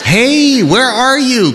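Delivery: high, bright eager call; excited call. A narrator's reading. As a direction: call out to him, delighted. Kate calls out excitedly.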